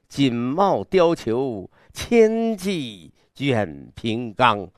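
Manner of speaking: slow, broad and imposing; grand recital. Shown as a demonstration. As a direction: paint a grand hunting array slowly. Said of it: "这阵势". (有阵势地慢慢朗诵)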